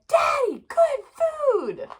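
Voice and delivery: very high, loud squeal; squeaky kid enthusiasm; eager child voice. A narrator's reading. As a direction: sell it to Dad like an excited little kid. Child pleading in story.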